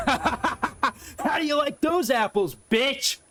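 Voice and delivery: high, slow, gloating through laughter; laughing taunt. Real, not acted. Gamer mocking a defeated enemy, slow laughing taunt. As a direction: rub it in while cracking up.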